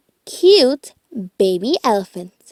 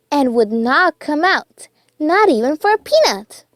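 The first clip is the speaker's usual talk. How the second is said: plain reading voice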